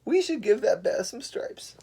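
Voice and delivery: high, eager zebra suggesting a plan; high cartoon zebra. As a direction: make the idea sound clever.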